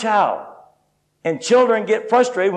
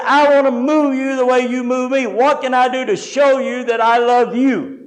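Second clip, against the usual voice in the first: frustrated outcry; 5 semitones higher than usual. Retold or imitated. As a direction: cry out to God in frustration, slowing at the end. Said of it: "in frustration I cried out".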